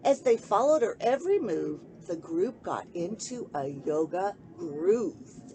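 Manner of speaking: put-on rhyming picture book read aloud to children; lilting sing-song storybook reading; story narration. A narrator's reading. Host reading a bedtime book to kids, gentle storytelling.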